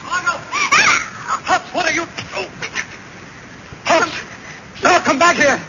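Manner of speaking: high, loud urgent shouts; shouting. Acted. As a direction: yell after the runaway. Chasing the fleeing ghost.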